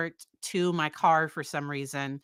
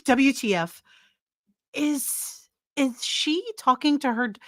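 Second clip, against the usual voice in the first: baffled outburst; 8 semitones higher than usual. Real, not acted. Commentator reacting to the 911 call, incredulous. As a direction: spell it out, incredulous at what was heard.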